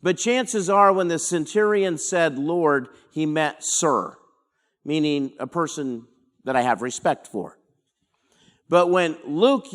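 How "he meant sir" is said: ordinary delivery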